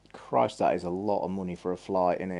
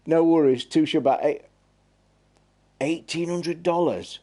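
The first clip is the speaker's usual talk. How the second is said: high hammed-up Aussie accent; broad Aussie accent, slowing at the end; mock Aussie accent; 6 semitones higher, 3 dB louder than usual. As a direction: play an Aussie shopkeeper. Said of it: "I won't do my Aussie accent".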